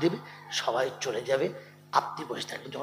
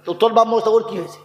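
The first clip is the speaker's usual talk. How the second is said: loud, high, scolding sermon voice; fiery preaching; 9 semitones higher, 8 dB louder than usual. Acted. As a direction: rail at the crowd at full force. (শ্রোতাদের ধমক দিয়ে উচ্চস্বরে ওয়াজ)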